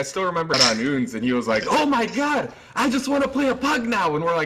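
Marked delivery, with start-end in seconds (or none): 1.7-4.2 high-pitched mock exclamation; excited retold quote. Commentator retelling a player's words after a record game, mock excited.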